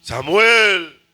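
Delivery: loud, resounding call; God's strong voice. Acted. God calls the sleeping boy, in a strong loud voice. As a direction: belt the name out, long and swelling. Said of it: "oigo una voz fuerte y la oigo clara".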